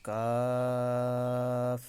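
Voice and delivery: কাফ drawn out very slowly; Arabic letter demo. Shown as a demonstration. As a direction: hold কাফ long and clear. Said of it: "আরেকবার বলি". (ধীরে টেনে কাফ উচ্চারণ)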